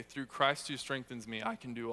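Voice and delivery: reverent reordered verse, unhurried; Yoda word order. Shown as a demonstration. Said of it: "If you were to Yoda this verse".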